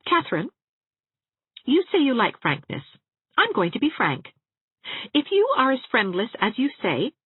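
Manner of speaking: frank. Acted. Anne frankly rebuking Catherine.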